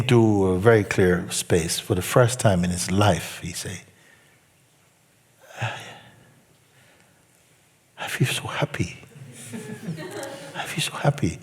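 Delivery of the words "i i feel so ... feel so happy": whispered, awed; hushed wonder. Retold or imitated. Student quietly tells the teacher he feels happy, whispered. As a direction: breathe it out like a revelation.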